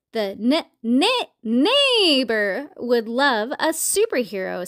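stuttered whinny stretched on neighbor; neighing horse. Acted. Horse suggests a superhero costume, neighing. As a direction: neigh the word neighbor, slow and drawn out.

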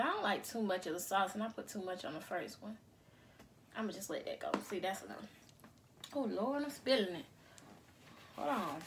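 soft, low mumbling while busy; quiet. Real, not acted. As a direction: murmur to yourself, softly. Quietly drizzling sauce.

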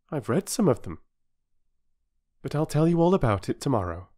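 high, soothing mother's voice; mother calming the station master; reassuring mother. Acted.

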